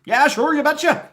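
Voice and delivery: loud, squeaky Midwestern send-up; high Midwestern accent. Acted. Agreeing with a neighbor in high, loud Midwestern caricature. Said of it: "a high Midwestern accent".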